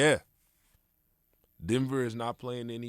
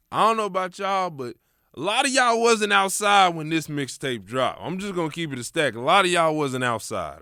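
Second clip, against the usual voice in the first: louder, higher excited rant; hyped; 9 semitones higher, 11 dB louder than usual. Real, not acted. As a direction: hype the crowd up.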